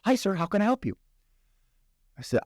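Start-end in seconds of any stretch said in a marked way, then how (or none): 0.0-1.0 high, eager-to-please phone greeting; very excited. Acted. Kitchen clerk takes the guest's second call, very excited. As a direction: act out a clerk thrilled to help. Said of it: "very excited, very happy to help".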